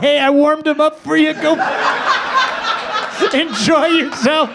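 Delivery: teasing a friend in the waiting room; joking through laughter. Retold or imitated. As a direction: quip while cracking up. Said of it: "And I said".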